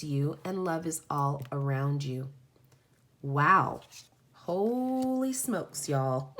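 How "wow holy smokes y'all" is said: awed. Real, not acted. After finishing the reading, slow awed exclamation. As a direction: let the awe stretch the words.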